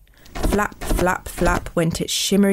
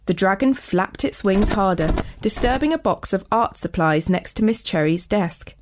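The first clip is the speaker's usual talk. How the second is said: plain reading voice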